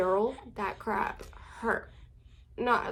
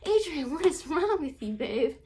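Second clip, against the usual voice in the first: playful scolding; 6 semitones higher than usual. Retold or imitated. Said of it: "I was just like".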